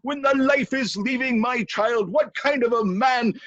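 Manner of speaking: high, loud royal tirade; indignant scolding shout, mimicking an earlier telling-off; outraged king. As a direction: berate your servant at full pitch. King berates servant with wife's line.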